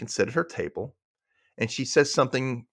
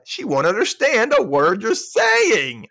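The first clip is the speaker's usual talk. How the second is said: punchline; 10 semitones higher, 6 dB louder, slower than usual. Acted. Punchline quote of Susan.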